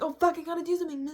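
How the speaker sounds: mock panic. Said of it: "I'm like".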